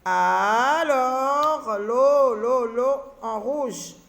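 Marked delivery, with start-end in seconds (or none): 0.0-1.8 "Alors" stretched long and loud; drawn-out syllables. Shown as a demonstration. Teacher making the sounds heard, "Alors" loudly drawn out. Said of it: "j'ai deux syllabes". 1.8-3.0 "l'eau" held and repeated as a separate beat; isolated syllable repeated. Shown as a demonstration. Teacher isolating the second syllable, "l'eau" held and repeated. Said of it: "j'ai deux syllabes".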